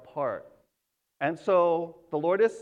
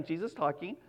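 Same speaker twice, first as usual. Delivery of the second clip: ordinary talk, nothing marked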